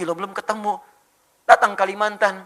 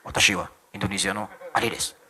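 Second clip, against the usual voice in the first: low, softer Japanese accent; Japanese speaker impression; 8 semitones lower, 6 dB quieter than usual. Shown as a demonstration. Introducing himself in Japanese for laughs, low Japanese accent. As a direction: drop deep for mock Japanese. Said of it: "kayak orang Jepang".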